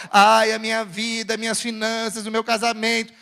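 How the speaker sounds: droning, monotone mock lament; mock complaining. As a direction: mimic a self-pitying moaner on one note. Mimics a self-pitying complainer.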